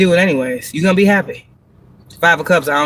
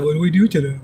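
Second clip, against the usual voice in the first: stuck people mocked; 2 semitones lower, faster than usual. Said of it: "these motherfuckers".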